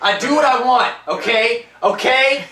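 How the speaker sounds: high, loud mock defiance; playful high-pitched defiance; defiant. Real, not acted. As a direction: sass back at the teasing. Defiant reply to teasing.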